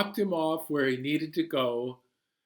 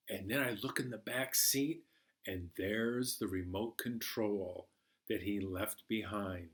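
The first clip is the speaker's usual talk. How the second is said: hushed storytelling; 2 semitones lower, 7 dB quieter than usual. A narrator's reading. Hushed dark suspense narration.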